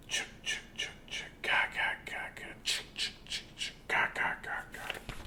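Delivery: voiceless creepy clicking chant; soft eerie whispered chant; spooky whisper chant. A narrator's reading. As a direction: whisper it like a ghost in the graves. Spooky graveyard noise.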